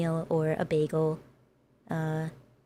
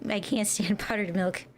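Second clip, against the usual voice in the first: normal talking voice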